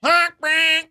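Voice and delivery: wild high-pitched screaming rant; crazy homeless yelling. Retold or imitated. Mimicking homeless people screaming outside Andy's apartment, wild high shout. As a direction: belt it out like a deranged street screamer. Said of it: "crazy homeless people going".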